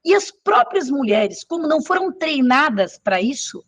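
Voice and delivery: ordinary delivery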